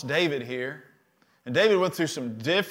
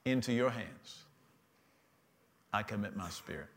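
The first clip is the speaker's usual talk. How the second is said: quiet surrender; 6 semitones lower, 12 dB quieter than usual. Acted. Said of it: "was able to say".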